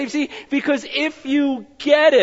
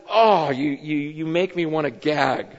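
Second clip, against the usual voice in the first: disgusted God; 7 semitones lower, 3 dB quieter than usual. Retold or imitated. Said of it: "God says".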